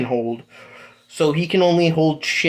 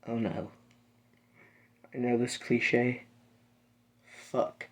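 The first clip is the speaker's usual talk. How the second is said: much softer, sinking whisper; dread; 3 semitones lower, 13 dB quieter than usual. Acted. Deku realising the cliche, hushed dread whisper. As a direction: barely breathe it out in dread. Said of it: "and he goes".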